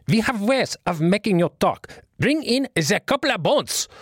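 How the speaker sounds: high, menacing foreign villain voice; menacing war movie villain accent; war movie interrogator. Retold or imitated. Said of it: "like it was some instrument of torture from a World War Two movie".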